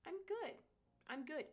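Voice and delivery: high, loud "no thanks"; emphatic refusal. Real, not acted. Refusing a foot tattoo, high and emphatic.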